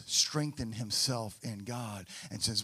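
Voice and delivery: usual speaking voice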